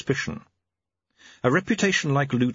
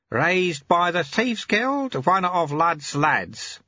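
lightly voiced old sweeper; 8 semitones higher, much slower than usual. A narrator's reading.

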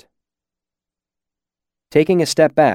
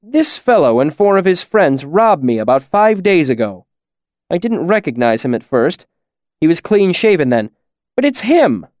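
indignant, raised voice; heated complaint, pitched higher; angry accusation; 6 semitones higher, 4 dB louder than usual. A narrator's reading. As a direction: accuse the man who robbed you. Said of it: "Chronicler's expression was angry".

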